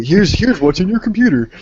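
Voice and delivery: high, bright presenter voice; kids' show host. Acted. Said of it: "would have sounded better if Barney".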